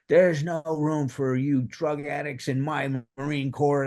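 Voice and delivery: imagined sergeant blowing up. Retold or imitated. Imagined first sergeant berating the corporal: slow mock-angry bark. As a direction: play the gruff first sergeant tearing into a drug addict. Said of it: "I expected him to blow his gasket".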